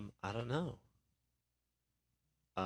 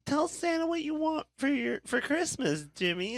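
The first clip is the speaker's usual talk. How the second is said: high, sugary mock-woman falsetto; imitated lady. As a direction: coax a child in a put-on lady's voice. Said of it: "the lady's like".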